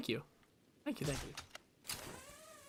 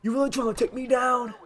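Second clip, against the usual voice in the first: much higher taunting mobster voice; Penguin impression; 9 semitones higher than usual. Gamer imitating the Penguin's taunt. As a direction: taunt Batman like a gangster. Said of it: "He's like".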